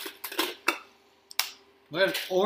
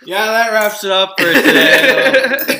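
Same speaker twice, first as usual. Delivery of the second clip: laughing; 7 semitones higher, 12 dB louder, slower than usual. Real, not acted. After tasting vomit bean.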